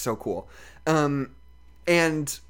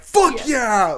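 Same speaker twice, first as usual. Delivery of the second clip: cheer; 7 semitones higher, 4 dB louder than usual. Retold or imitated. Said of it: "cheer in the audience".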